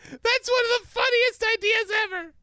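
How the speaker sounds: very high, loud, laughing squeal; hysterical high voice. Real, not acted.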